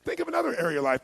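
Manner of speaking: ordinary talk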